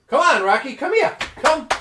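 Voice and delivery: loud, high call across the bar; hammed-up calling out to Rocky; calling the dog.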